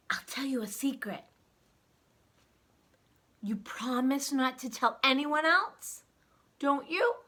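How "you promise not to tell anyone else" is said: ordinary delivery, nothing marked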